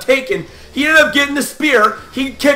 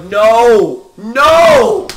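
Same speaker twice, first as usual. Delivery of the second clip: louder, rising howl of denial; protesting cry; 6 dB louder than usual. Retold or imitated. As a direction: re-enact a building, disbelieving yell. Retold refusal to believe the loss.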